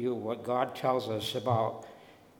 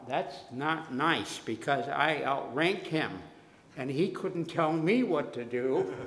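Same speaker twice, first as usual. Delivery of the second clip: normal talking voice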